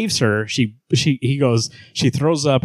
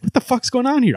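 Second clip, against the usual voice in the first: much higher, incredulous; confused; 12 semitones higher than usual. Retold or imitated. Reader baffled by the comic's narration switch, high incredulous squeak. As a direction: squeak out total disbelief. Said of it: "And I was like".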